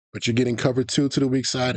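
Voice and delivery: normal talking voice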